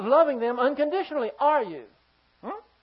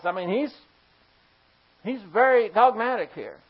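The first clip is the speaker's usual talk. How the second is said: ordinary talk, nothing marked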